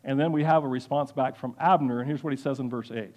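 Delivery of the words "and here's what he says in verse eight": ordinary talk, nothing marked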